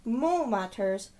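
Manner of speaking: put-on lively read-aloud voice for children; storybook narration. A narrator's reading. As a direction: tell it like a bedtime picture book. Reading picture book aloud.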